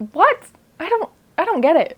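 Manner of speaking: sudden high incredulous what; baffled. Real, not acted. Reviewer shocked by the mother's advice, high and incredulous. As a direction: squeak in shocked disbelief.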